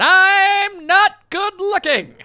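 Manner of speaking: very high, drawn-out squawk; slow, high-pitched self-mocking cry; comic outburst. Real, not acted. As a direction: stretch it out in a high mock wail.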